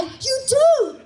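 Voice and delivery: high, loud, eager cheering voice; eager puppy hype men. Retold or imitated. The boys cheering Suze on like puppies, high and loud. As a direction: gush like an overexcited puppy. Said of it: "they're like".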